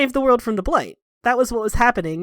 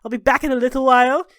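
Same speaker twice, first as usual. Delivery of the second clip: higher and louder, huffy and put-upon; exasperated servant; 5 semitones higher, 6 dB louder than usual. Acted. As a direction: give in with an offended huff. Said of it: "And she's like".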